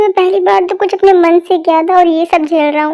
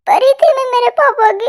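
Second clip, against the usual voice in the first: usual speaking voice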